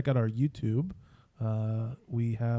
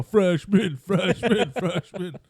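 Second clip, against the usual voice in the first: frat bro; 5 semitones higher, 3 dB louder, much slower than usual. Retold or imitated. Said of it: "then I go".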